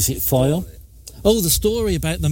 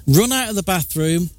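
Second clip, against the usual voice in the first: usual speaking voice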